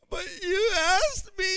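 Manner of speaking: high whimpering protest; mock tearful child. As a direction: imitate a crying daughter. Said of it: "she starts crying".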